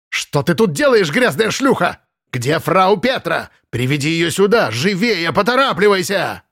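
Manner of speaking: high, sharp furious scolding; angry scolding. Acted. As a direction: berate her, drive her out. Housekeeper berates intruding maid.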